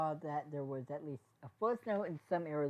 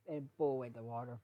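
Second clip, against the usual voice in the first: usual speaking voice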